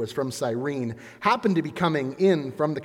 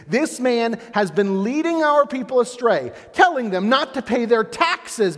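accusing Pilate; 9 semitones higher, 6 dB louder than usual. Acted.

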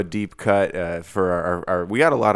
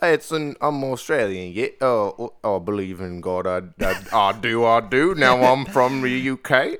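hammed-up, shaky attempt at an Australian accent; 3 semitones higher than usual. Joking as the Australian musician, bad Australian accent. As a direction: put on a mock Australian accent. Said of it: "you're doing your Australian thing".

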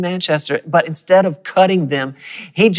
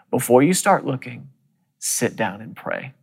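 quiet inner voice; 3 semitones lower, 6 dB quieter than usual. Retold or imitated. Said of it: "I heard the Lord speak to me on the inside".

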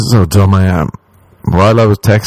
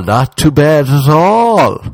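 Irish-style drawl, higher and slow; mock Irish understatement; 6 semitones higher, slower than usual. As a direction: stretch it out like a stoic Irishman. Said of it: "you'd be like".

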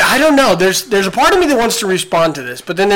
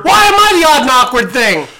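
loud, high, laughing outcry; indignant laughing shout; laughing protest; 7 semitones higher, 5 dB louder than usual. Real, not acted. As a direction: shout it through a laugh, mock offended. Mock outrage at being called awkward.

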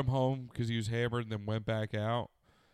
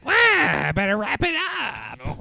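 much higher, loud and slow; mock high voice; 12 semitones higher, 10 dB louder, much slower than usual. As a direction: squeak out a cartoon sign-off. Mock outro.

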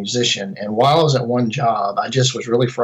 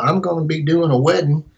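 higher-pitched Tennessee twang; Tennessee accent; 4 semitones higher than usual. Retold or imitated. Video company guy offering a caller work, Tennessee accent. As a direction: put on your best Tennessee accent, voice lifted. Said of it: "This is my best Tennessee accent".

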